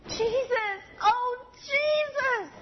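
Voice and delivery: high, slow, tearful wail; weeping little girl calling out; child crying out. Retold or imitated. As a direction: cry his name like a heartbroken girl. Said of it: "began to cry instead of scream".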